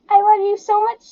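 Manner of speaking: high, loud, gushing love voice; annoying voice. Pretend. Teasing the couple behind her, high and loud. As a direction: squeal it like an annoying clingy partner. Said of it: "like, annoying type voice".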